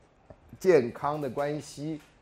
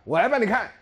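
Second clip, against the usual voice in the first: eager offer to help; 7 semitones higher, 6 dB louder than usual. Retold or imitated. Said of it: "你说".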